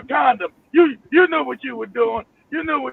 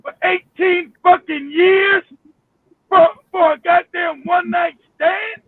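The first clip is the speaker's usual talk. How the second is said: outraged shout; 5 semitones higher than usual. Real, not acted. Outraged about paying child support.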